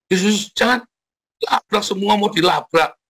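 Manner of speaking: high, exasperated Jesus, don't; exasperated exclamation. Retold or imitated. Preacher exclaiming at a churchgoer who confronts everyone, high and incredulous. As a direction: cry out in disbelief at someone boasting.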